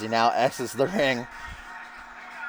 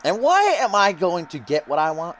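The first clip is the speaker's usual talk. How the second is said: high, self-important wrestler promo; Russell Pontarelli; 8 semitones higher than usual. Acted. Commentator voices the newcomer's boast and stumbles, cocky wrestler voice. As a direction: pose the question grandly, climbing higher. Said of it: "He says".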